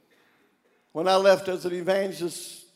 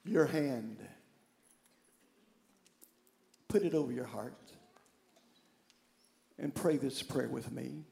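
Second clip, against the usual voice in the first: hushed prayer invitation; gentle altar call; 4 semitones lower, 5 dB quieter than usual. Real, not acted. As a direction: guide them quietly into prayer.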